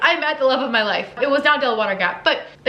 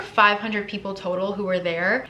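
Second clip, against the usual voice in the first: normal talking voice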